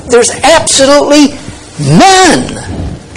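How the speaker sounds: ABSOLUTELY pitched high, a beat, then NONE falling; emphatic stress. Shown as a demonstration. Preacher restating the verse for force, stress on ABSOLUTELY NONE. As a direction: lift ABSOLUTELY, pause, land firmly on NONE. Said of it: "really trying to stress".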